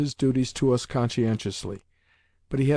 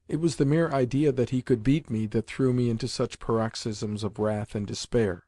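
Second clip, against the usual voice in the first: ordinary delivery, nothing marked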